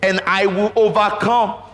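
rising sermon fervor. Real, not acted. Preacher's fervent second climax.

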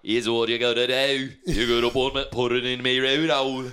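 put-on botched Australian accent. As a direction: fake Aussie accent sliding into Scottish. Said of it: "That's Scottish or something".

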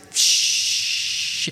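long "shh" of gushing water; imitated rushing water. Preacher imitating the water he heard behind the machine, long hiss. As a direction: hiss like a burst pipe. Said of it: "all I hear is".

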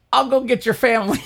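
high, quick, eager offer; lightly voiced busybody neighbour offering help; helpful lady. Store lady offering to run for the family, high and quick.

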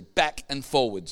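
usual speaking voice

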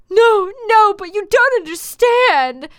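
high, loud, hammed-up plea; pleading. Draco begging his furious girlfriend, overacted pleading. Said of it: "Draco pleaded".

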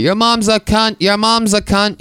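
high, repeated curse; old man yelling from down the street; mock old man shouting. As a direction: squawk it from afar. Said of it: "I can hear him".

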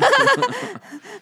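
a loud burst of laughter. Real, not acted. Husband laughing at wife's joke, loud burst of laughter.

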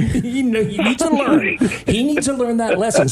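high, insistent hammed-up parent; mock stern father. Fake father justifying himself to elderly lady, mock insistence. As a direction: insist on it like an outraged dad.

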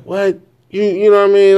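a loud retold "what?" of disbelief; incredulous outburst. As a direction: blurt it out like you can't believe what she said. Shocked reply to partner.

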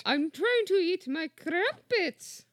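a hammed-up English lady over her crumpets; British lady accent. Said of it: "Does that sound like a good English accent".

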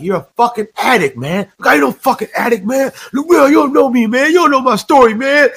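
高く大声でまくし立てる鬼教官の真似; 高い声で相手を追い詰めるように; imitating T. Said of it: "he would be like".

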